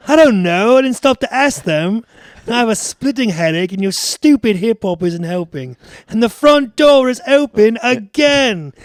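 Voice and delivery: loud, high exasperated outburst; angry quarrel. Acted. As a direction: act out a fed-up flatmate's rant. Acted movie quarrel between flatmates.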